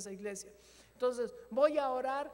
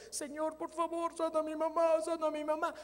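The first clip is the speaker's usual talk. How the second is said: higher, tearful-edged begging; a touch of a son's sobbing prayer; mild imitated crying plea; 6 semitones higher, 3 dB louder than usual. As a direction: beg like a son weeping over his mother.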